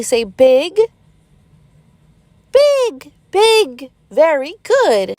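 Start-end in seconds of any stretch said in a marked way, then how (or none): 2.5-3.9 put-on much higher childlike echo of "alligator"; eager child repeating "alligator"; kids repeating. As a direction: squeal it back like a small pupil. Pretend pupils repeat the word.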